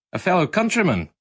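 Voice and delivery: Scottish brogue, lightly done; lilting Scottish accent. A narrator's reading. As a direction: voice the young Scotsman's greeting with a lilt. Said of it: "in a lilting Scottish accent".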